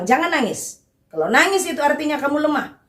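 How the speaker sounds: playful impression of a scolding parent. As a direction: imitate a stern father forbidding tears, pitch up. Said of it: "Karena dari kecil dibilang".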